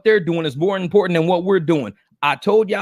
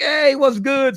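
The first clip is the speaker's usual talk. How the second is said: friendly greeting; 8 semitones higher, much slower than usual. Retold or imitated. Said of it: "I'm like".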